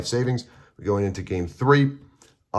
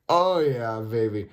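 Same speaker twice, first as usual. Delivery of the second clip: drawn-out, high-pitched "oh yeah"; gloating delight; 3 semitones higher, slower than usual. Retold or imitated. Player on drawing the perfect card, gloating delight. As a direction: savor a lucky draw, mock-sultry delight. Retold delight at lucky draw.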